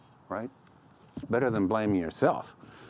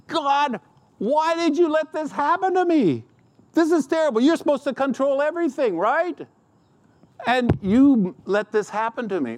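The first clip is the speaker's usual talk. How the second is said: high, loud shout shaking a fist at the sky; a crashed driver raging at heaven; furious outburst at God; 6 dB louder than usual. Retold or imitated. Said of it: "he shakes his fist at God".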